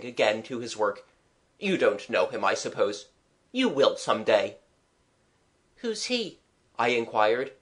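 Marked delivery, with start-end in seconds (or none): 5.8-6.4 put-on high, small child's question; curious child. Child asking the artist about a stranger's name, curious. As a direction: pipe up with innocent curiosity.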